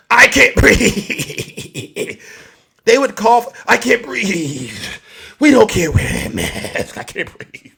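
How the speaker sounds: slow, drawn-out I can't breathe; mock the anti-masker; hammed-up whine; whiny mock complaint. Mocking maskless complaint.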